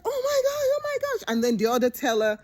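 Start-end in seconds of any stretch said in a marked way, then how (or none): 0.0-1.2 high, crying panic; very high mock panicked cry; freaking out. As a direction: squeal in terror like a scared young teller. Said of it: "she freaks out".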